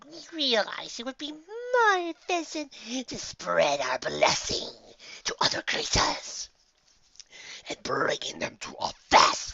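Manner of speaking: put-on breathy, hushed old entity; whispered voice of the ancient Allfather; whispery ancient Allfather. A narrator's reading. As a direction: speak like an ancient creature from the shadows. Allfather long tale.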